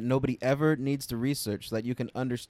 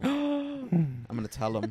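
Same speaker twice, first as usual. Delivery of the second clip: mock gasp; 5 semitones higher, much slower than usual. Gasp, threatening to tell.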